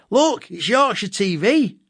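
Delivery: put-on high, slow child's excited call; excited call to dad. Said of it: "I shout to my dad".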